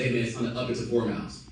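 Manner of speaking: ordinary talk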